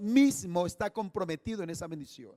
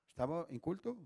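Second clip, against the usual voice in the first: much softer, almost under the breath; quiet echo; 17 dB quieter than usual. Real, not acted. Pastor softly echoing to himself that he was in church. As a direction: let "Estaba en culto" fall away softly.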